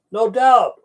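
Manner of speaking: "No doubt!" falling, as if it finally clicked; sudden recognition. Real, not acted.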